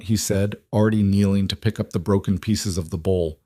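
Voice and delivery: plain reading voice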